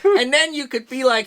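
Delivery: normal talking voice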